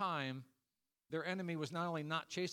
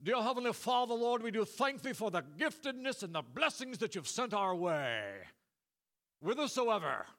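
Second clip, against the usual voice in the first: loud, high, florid pulpit praying; mock an overblown old-time Baptist prayer; long Baptist prayer; 4 semitones higher, 4 dB louder than usual. Preacher parodying a long Baptist prayer, hammed-up and loud. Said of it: "imagine a long Baptist prayer right there".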